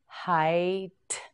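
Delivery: Height stretched slowly, each sound held long; slow drawn-out word. Shown as a demonstration. Teacher modelling Height for learners, slowly stretched. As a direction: draw out Height for the learner to copy. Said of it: "teacher models new word".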